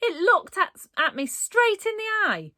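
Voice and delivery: high, loud stammering fright; spluttering. A narrator's reading. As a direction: stumble over the words in fright. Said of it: "spluttered the boy".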